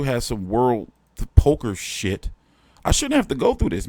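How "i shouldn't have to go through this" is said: high, fast whining protest; whiny complaint. Real, not acted. Streamer whining to chat about changing channels, high fast whine.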